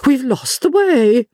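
very high, theatrical dismay; alarmed exclamation. Acted. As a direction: cry out as if suddenly realising disaster. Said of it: "broke off and exclaimed".

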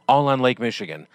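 upbeat, punchy commercial voice; radio ad read. Acted. Announcer reading a boat dealer ad to listeners, upbeat ad read. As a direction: sell it like a local radio spot.

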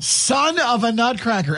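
high, slow playful title; mock song title. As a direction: announce the parody title grandly. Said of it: "holiday remix called Son of a Nutcracker".

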